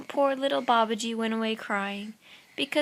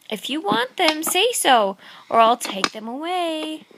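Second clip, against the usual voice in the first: higher, louder teasing challenge; teasing boy; 6 semitones higher, 5 dB louder than usual. Acted. Little Babaji daring the tigers, higher teasing voice. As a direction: dare the tigers playfully.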